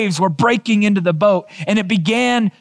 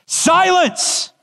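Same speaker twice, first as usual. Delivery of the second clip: sharp high command to the storm; Jesus rebuking the waves; commanding rebuke; 11 semitones higher than usual. Retold or imitated. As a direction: silence the wind like a master. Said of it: "he rebuked the wind".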